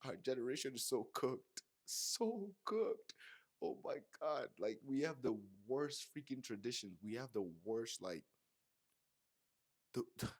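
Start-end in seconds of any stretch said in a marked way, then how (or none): none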